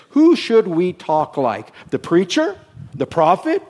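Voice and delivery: usual speaking voice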